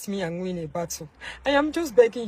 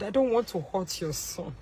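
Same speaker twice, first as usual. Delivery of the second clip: normal talking voice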